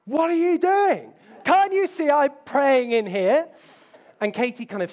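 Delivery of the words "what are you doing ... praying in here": high, loud, slow indignant snap at his wife; hammed-up furious yell; mock angry outburst. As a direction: play the pious man losing his temper, slowly and loudly. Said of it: "I'm like".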